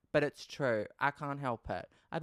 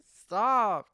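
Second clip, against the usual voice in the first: embarrassed shout; 9 semitones higher, 12 dB louder than usual. Real, not acted.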